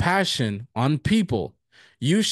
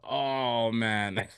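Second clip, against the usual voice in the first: soft, drawn-out groan of regret; retold dismay; 2 semitones lower, 5 dB quieter than usual. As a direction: sink into a quiet "oh no" moment. Retelling dismay at scratching the car.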